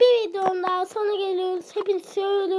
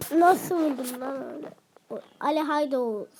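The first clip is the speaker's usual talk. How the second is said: ordinary delivery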